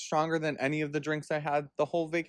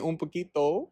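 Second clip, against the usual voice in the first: normal talking voice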